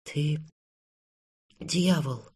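soft, halting near-whisper; hushed, faltering words. A narrator's reading. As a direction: breathe the accusation out quietly, with a long pause. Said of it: "прошептала Анна".

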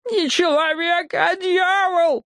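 shrieky high squeal, stretched out at the end; squeak. A narrator's reading. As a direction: squeal it at the very top of the voice. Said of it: "пискнул он". (пронзительный писк с протяжным концом)

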